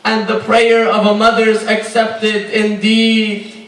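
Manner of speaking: normal talking voice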